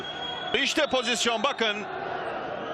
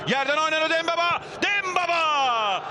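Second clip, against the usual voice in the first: screaming football commentator; 5 semitones higher than usual. Real, not acted. Goal call by commentator.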